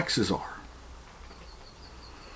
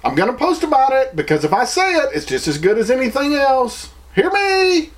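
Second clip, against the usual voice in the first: mocking virtue signaler; 12 semitones higher than usual. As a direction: parody a show-off demanding everyone hear him. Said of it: "those people that's over like".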